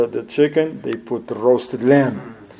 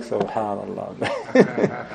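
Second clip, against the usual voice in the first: amazed laugh; 6 semitones lower, 7 dB quieter, much slower than usual. Retold or imitated. Guest realizes the custom.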